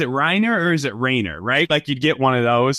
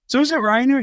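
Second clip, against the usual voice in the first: much higher, sing-song mock asking; mock group question; 13 semitones higher than usual. Friends asking Reiner his name, high teasing mock question. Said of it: "we're like".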